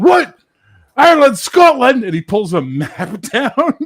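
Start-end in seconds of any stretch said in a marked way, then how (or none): 0.0-2.0 high, loud outraged yell; loud high mock Scottish outrage; outraged Scot. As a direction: shriek of disbelief. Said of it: "He's like".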